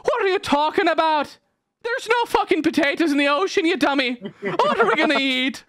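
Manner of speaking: very high-pitched, indignant leader; hammed-up outraged village chief; scornful Irish tribe leader. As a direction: scold a fool in a squeaky mock-Irish voice. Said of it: "O'Malley, the leader of their tribe, is like".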